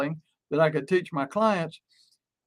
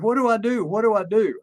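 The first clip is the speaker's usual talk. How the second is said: higher-pitched, mildly panicky imitation; retold frantic; 5 semitones higher than usual. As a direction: retell a client's mild fluster, pitched up. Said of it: "she was frantic".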